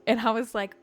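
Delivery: ordinary delivery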